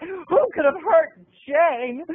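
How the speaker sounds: ordinary talk, nothing marked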